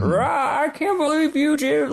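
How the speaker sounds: high-pitched hammed-up old-man outburst; mock indignant old codger, high and strained; crotchety old man. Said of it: "like a crotchety old man".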